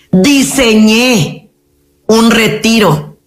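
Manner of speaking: Diseñé drawn out very slowly, then un retiro slow; slow emphasis. Real, not acted. Therapist stresses her effort to her audience, slow and deliberate. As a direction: stretch Diseñé un retiro word by word. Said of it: "Escúchame, escúchame".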